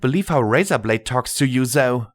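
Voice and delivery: put-on high childlike girl voice; young filly voice. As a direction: speak as a cheeky young filly. Filly Scotch Tape speaks.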